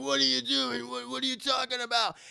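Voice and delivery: protesting teammate. Retold or imitated. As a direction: imitate a dismayed friend protesting. Retold teammate protests betrayal.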